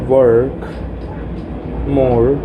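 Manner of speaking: ordinary talk, nothing marked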